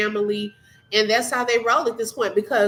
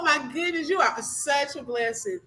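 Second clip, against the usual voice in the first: normal talking voice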